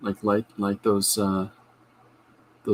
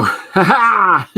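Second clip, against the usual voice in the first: gleeful laugh; 9 semitones higher, 10 dB louder than usual. Retold or imitated. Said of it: "You go".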